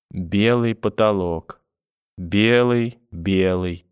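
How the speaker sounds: very slow, drawn-out murmur; delirious, vacant. A narrator's reading. Grandmother staring past grandson at ceiling, dazed and drifting. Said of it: "словно сквозь меня".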